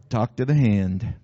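low, slow mock brush-off; low drawn-out talk to the hand; dismissive God. God brushing off an unanswered prayer, low and dismissive. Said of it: "God was up in heaven going".

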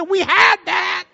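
high, slow, drawn-out we had that; dry retort. Retold or imitated. Pastor answering burnt-orange carpet request, slow high retort. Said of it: "and I said".

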